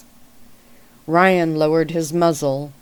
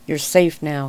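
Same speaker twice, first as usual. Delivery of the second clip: plain reading voice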